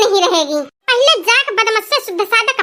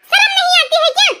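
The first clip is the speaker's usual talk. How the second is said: high, sharp indignant outburst with a laugh; daughter-in-law hits back at her mother-in-law, high indignant outburst; indignant retort; 7 semitones higher, faster than usual. Acted.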